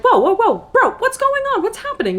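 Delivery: high-pitched retold bafflement; startled disbelief. Retold baffled reply to his outburst.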